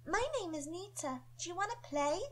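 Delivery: put-on high, bright little girl voice; cute playful girl. Young Nita introducing herself and inviting play, cute. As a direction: invite them to play, cutely. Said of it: "sound curious, playful and cute".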